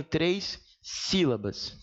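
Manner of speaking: very slow, with a pause before sílabas; contagem enfatizada. Shown as a demonstration. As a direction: draw out três, then place sílabas apart. Stretching the syllable count while teaching. (bem devagar, com pausa)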